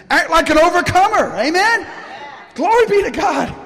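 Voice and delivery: high-pitched rallying cry; exultant preaching. Real, not acted. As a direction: urge the congregation on, voice lifted right up. Preacher's rousing exhortation.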